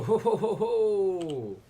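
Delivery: high, loud ho-ho laughter; loud mock Santa chuckle; gleeful laugh. Real, not acted. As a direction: laugh like a happy villain.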